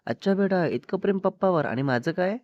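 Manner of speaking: lightly acted jealous mama; mock-jealous mother. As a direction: tease the child with feigned jealousy. Mother mock-jealous of child's love.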